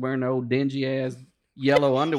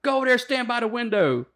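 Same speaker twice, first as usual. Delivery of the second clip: mock order; 8 semitones higher than usual. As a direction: order him over, mocking. Instructor ordering.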